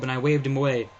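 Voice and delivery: ordinary delivery, nothing marked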